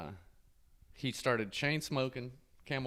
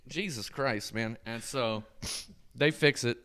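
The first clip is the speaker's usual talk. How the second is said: normal talking voice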